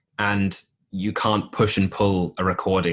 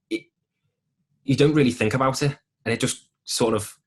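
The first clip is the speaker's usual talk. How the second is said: higher-pitched Scouse, thinking aloud; Scouse accent; 4 semitones higher, 3 dB louder than usual. Shown as a demonstration. Actor demonstrating their stage accent on request, in Scouse. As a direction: put on a Liverpool accent, pitched up. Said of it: "want you to do a Scouse accent".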